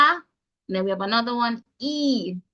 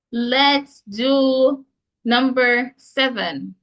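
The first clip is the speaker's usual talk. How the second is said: ordinary delivery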